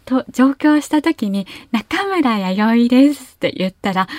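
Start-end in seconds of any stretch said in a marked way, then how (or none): 1.7-3.3 slow 中村弥生 rising on か; rising Kyushu intonation. Shown as a demonstration. Self-introduction in Tokyo, slow rising 中村弥生. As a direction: lift the pitch through 中村. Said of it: "上がるイントネーション".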